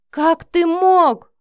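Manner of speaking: very loud, high, stretched-out yell; outraged scream. A narrator's reading. Mother confronting her son in his flat, screaming. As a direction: hurl it as a drawn-out accusation. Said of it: "заорала Лидия".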